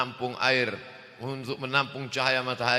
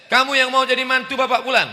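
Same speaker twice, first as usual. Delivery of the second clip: high, loud, quick stern examiner; booming future father-in-law; stern tester; 12 semitones higher, 10 dB louder, faster than usual. Acted. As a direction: grill the suitor from up high.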